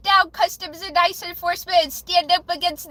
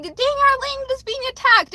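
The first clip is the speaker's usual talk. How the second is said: high-pitched, louder mock outcry; acted alarm; 9 semitones higher, 4 dB louder than usual. Imagining Ukrainians saying their land is attacked, high alarmed outcry. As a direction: cry out as someone under attack, pitched high.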